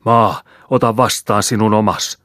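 high, loud commanding cry; panicked priest bellowing a command; terrified roar. A narrator's reading. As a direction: roar at the earth in horror. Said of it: "Kauhistunut pappi paiskasi arkkua kirjalla ja karjaisi".

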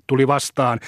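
ordinary delivery

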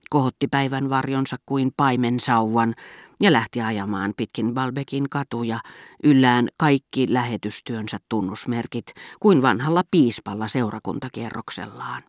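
ordinary delivery, nothing marked

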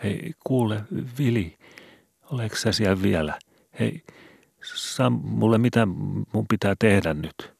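put-on high, anxious boy's plea; trembling voice. A narrator's reading. Skobe calls to Vili in the dark, trembling. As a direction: let the voice quiver with fear. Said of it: "sanoi vapisevalla äänellä".

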